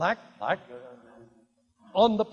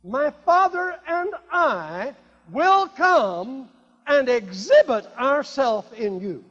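loud, high, slow preacher's declaration; forceful scripture proclamation; 4 semitones higher, 7 dB louder, slower than usual. Acted. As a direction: proclaim each phrase slowly at full force.

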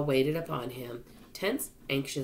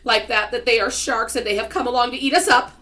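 loud, high-pitched panicked outcry; alarmed, much louder and higher; crying out in alarm; 9 semitones higher, 10 dB louder than usual. A narrator's reading. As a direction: cry out the danger to everyone. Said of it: "cried Earthworm".

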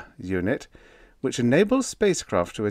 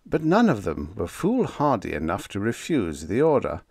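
ordinary delivery

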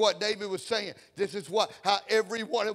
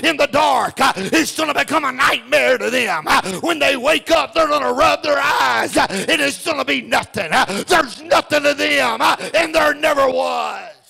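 loud, higher chanted preaching; full-force preaching; 5 semitones higher, 12 dB louder than usual. Acted. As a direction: drive it home to the crowd. Preacher's climax on the wicked.

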